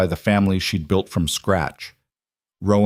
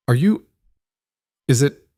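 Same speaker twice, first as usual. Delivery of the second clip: halting, higher-pitched questions with long pauses; stammering; 6 semitones higher, 4 dB louder than usual. A narrator's reading. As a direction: stammer it out in broken starts, pitched up. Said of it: "he, he stammered".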